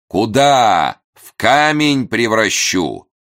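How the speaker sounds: loud, high, drawn-out theatrical threat; resonant voice. A narrator's reading. Unseen voice from behind the door, resonant and menacing. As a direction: boom it out, stretching the words. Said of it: "чей-то звучный голос произнёс странные слова".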